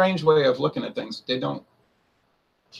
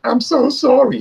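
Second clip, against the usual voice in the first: much higher, louder whimpering apology; mock Trent Lott; 10 semitones higher, 4 dB louder than usual. Pundit mocking Trent Lott's apology, high and whiny. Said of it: "Trent Lott".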